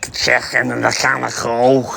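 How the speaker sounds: exaggerated throaty Scouse, slow; broad Scouse accent. Shown as a demonstration. Mimicking grating broad Scouse for viewers, exaggerated Scouse accent. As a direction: overdo the Scouse throat-scraping consonants. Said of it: "not like a dead broad accent".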